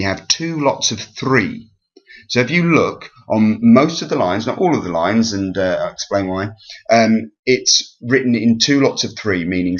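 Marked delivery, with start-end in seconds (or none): none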